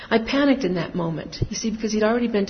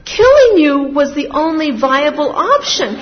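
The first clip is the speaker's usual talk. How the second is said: loud and higher, with laughter breaking through; laughing retort; 7 semitones higher, 10 dB louder than usual. Retold or imitated. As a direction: deliver the shocking answer loudly, half laughing. Her blunt retort, laughing.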